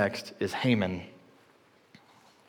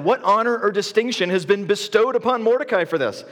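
eager king; 8 semitones higher, 3 dB louder than usual. Retold or imitated. King eagerly asks about reward.

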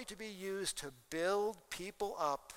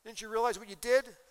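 higher, louder mock put-down, playing the jerk; a hammed-up rude rebuke; rude insult; 4 semitones higher, 5 dB louder than usual. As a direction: sneer at someone like a fool would. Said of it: "You don't turn around and say".

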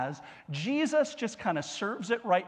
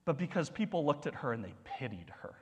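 hushed; 3 semitones lower, 7 dB quieter, faster than usual. Acted. Pastor contrasts judged vs pitied.

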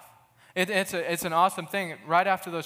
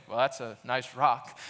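dismissive shrug; 5 semitones lower, slower than usual. Retold or imitated. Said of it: "instead of just looking at a stone and saying".

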